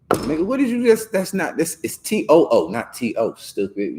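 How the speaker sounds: high-pitched mock scolding, rising to an exasperated jab; indignant correction. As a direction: correct someone online in a high, incredulous voice. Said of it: "I'll correct you on the internet".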